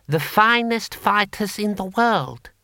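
high, slow and stately boast; proud boast. A narrator's reading. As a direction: draw out the praise in a high voice. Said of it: "said Six Beneficent Winds proudly".

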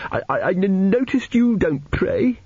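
put-on high, stammering child's voice; timid tearful boy. A narrator's reading. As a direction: stumble shyly into it, pitched high. Narrator voices tearful Arthur.

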